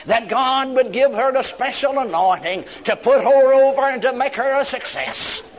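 high, insistent mock praying; imitated vigorous prayer. Preacher praying over a young woman, imitated vehemence. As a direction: pray with exaggerated vigor and vim. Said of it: "he prayed with much vigor, vim, and vitality".

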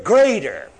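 "greater" punched on its first syllable; emphatic GREATER. Shown as a demonstration. Preacher showing believers which word matters, emphatic. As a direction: land firmly on "greater" by itself. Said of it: "I'm only emphasize that word".

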